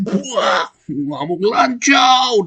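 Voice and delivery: high, drawn-out angry shout; furious yelling boss. Retold or imitated. Retelling a boss's rage in a meeting, furious shout. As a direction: bellow it like the raging boss. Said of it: "dia bilang begitu".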